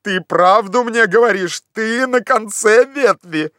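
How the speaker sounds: high, eager questioning cry; excited exclamation. A narrator's reading. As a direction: call up eagerly, stretching the words. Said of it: "вскричал Легран".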